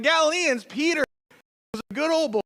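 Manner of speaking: a playful hint of a country good old boy. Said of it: "I think about a good old-fashioned redneck".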